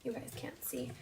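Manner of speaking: quiet self-talk, half off to the side; talking to oneself. Real, not acted. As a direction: murmur it as if to yourself. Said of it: "I'm just talking to myself over here".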